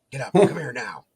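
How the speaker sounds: wife calling from the porch, urgent and loud. Retold or imitated. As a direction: hurry him out of bed. Wife urgently calling him over.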